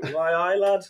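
high, loud Geordie greeting; mock Geordie lad greeting; Geordie accent. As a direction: do a hammed-up Geordie hello. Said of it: "Geordie Rob turns up".

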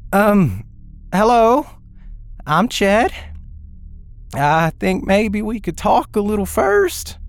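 high, nervous hesitant man; shaky voice. Acted. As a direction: speak up timidly from the dark. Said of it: "a man's shaky voice answered".

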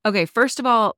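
normal talking voice

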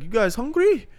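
put-on high, lilting go at a French accent; attempted French accent. Host mimics French billet lady asking boys, attempted French accent. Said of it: "Can't do a French accent".

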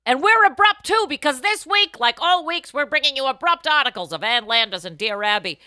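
loud, high hyped-up spiel; excited host. Acted. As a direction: pump up the audience. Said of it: "hyped host spiel".

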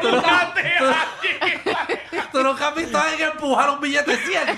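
high-pitched, talking through laughter; helpless laughter breaking into the words; laughing. Real, not acted. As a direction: crack up while getting the question out. Cracking up over bawdy joke.

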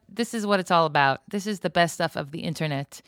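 ordinary talk, nothing marked

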